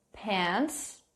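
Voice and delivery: "pants" said soft and deliberate with a clear final "ts"; clear pronunciation. Shown as a demonstration. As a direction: say "pants", not "pair". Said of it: "não é pair, é pants".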